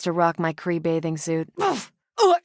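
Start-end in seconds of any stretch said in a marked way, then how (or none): 1.6-1.8 short grunt of pain as a blow lands. Acted. Hit in combat. 2.2-2.4 short grunt of pain as a blow lands. Acted. Hit in combat.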